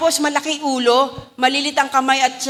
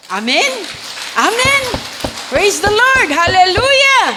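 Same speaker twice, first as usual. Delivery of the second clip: high, loud call to praise; rousing praise; 8 semitones higher, 6 dB louder, slower than usual. Real, not acted. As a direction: fire up the congregation. Preacher rousing congregation.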